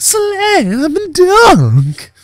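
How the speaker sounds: very high, loud, drawn-out dunk call; sports hype shout, hugely elongated; emphatic dunk shout. Real, not acted. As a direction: stretch every word into a huge celebratory yell. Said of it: "You gotta say it with emphasis".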